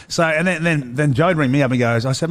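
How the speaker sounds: usual speaking voice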